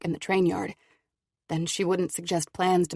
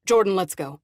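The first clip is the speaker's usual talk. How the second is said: firm interruption; 7 dB louder than usual. Acted. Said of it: "Thomas interrupts".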